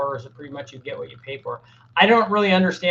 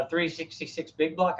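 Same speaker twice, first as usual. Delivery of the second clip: normal talking voice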